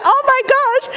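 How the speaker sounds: high-pitched comic exasperation; high, mock-despairing outburst; exasperated. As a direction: exclaim as an overwhelmed mother. Exasperated mother's inner reaction.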